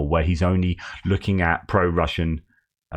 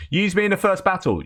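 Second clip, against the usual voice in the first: much higher, called out eagerly; rallying cry; 10 semitones higher than usual. Retold or imitated. Eager recruit calling to the unit, high rallying cry. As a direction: fire up the crowd with it. Recruit leads comrades' chant.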